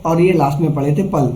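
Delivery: ordinary delivery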